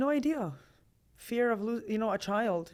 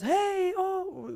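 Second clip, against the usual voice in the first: mock husband yell; 12 semitones higher, 6 dB louder than usual. Husband raising voice in fight.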